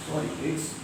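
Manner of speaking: usual speaking voice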